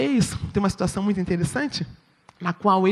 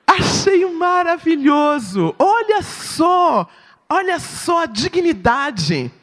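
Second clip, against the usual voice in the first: marveling; 7 semitones higher, 4 dB louder, slower than usual. Real, not acted. Preacher marvels at Jesus' dignity.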